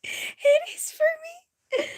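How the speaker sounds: breathless whisper through tears of laughter; laughing whisper. Real, not acted. Laughing hard at a video, breathless whisper. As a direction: wheeze it out, laughing too hard to voice it.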